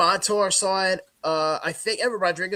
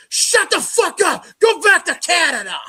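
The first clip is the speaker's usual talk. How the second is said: heckler's yell; 10 semitones higher, 7 dB louder than usual. Retold or imitated. Rival fan heckling the sign holder, yelling. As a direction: holler it like a hostile fan in the stands.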